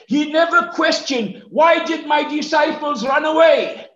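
impassioned preaching. Real, not acted. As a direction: drive each rhetorical question home from the pulpit. Preacher builds through rhetorical questions.

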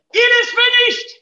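a high, loud triumphant cry; shout of victory. Acted. Jesus on the cross crying out in triumph, victorious shout. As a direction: call it out like a conqueror. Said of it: "It was a cry of a conqueror".

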